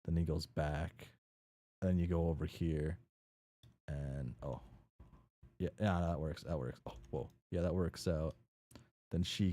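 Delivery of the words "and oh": normal talking voice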